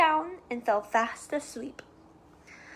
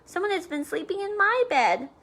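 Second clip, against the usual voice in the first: higher mother bear voice; lightly voiced mama bear, higher; middle-sized voice; 6 semitones higher than usual. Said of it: "in her middle-sized voice".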